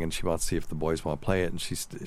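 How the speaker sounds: ordinary delivery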